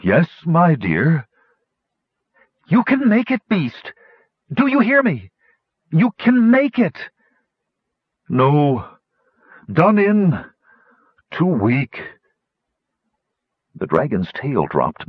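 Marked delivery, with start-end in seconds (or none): 0.0-1.3 lightly voiced failing dragon, drawn-out words; weary dragon. A narrator's reading. Failing dragon answers from far below. 2.7-7.1 high-pitched, insistent calling out; will a struggling friend on from afar; calling out encouragement, strained high; urgent urging. A narrator's reading. Urging the failing dragon across distance. 8.3-12.0 labored, broken-up phrases, pitched up; failing dragon giving up; exhausted dragon. A narrator's reading.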